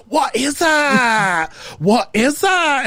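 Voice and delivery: put-on high, drawn-out sassy Scottish toddler. Imitating a YouTube toddler, high sassy Scottish accent. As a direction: imitate a sassy Scottish tot, stretching each word slowly. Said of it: "but with Scottish accents".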